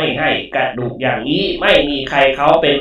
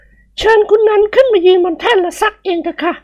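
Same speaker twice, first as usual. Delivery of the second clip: high polite woman's voice. Acted. Woman inviting her friend onto the platform, high voice.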